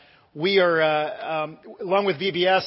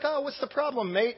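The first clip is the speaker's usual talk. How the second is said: relaxed friend; 7 semitones higher, 3 dB quieter than usual. Retold or imitated. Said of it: "he's the most relaxed guy".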